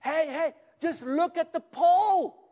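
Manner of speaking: calling out loud and high; urgent call across the camp; shouting. Retold or imitated. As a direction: shout it across to someone bitten. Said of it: "He shouts at her".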